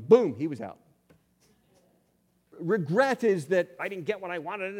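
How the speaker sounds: ordinary talk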